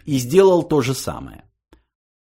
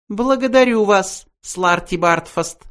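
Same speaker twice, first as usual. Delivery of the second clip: cold cutoff; 10 semitones higher than usual. A narrator's reading. Benji coldly cutting the old man short. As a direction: cut him off coldly. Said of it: "холодно отрезал Бенджи".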